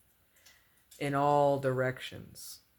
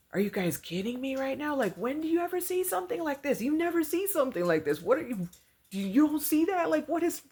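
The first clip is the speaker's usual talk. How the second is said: much higher, fast, worked-up disbelief; riled up; 12 semitones higher, faster than usual. Retold or imitated. To her stunned friends at the park, a much higher riled-up outburst. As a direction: shake them out of their daze. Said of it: "you know, riled up".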